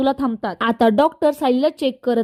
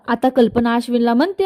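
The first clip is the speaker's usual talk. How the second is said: usual speaking voice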